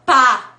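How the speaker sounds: "pá" said as a short burst from closed lips; phoneme demo. Shown as a demonstration. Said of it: "fecho minha boca e abro". ("pá" com os lábios explodindo)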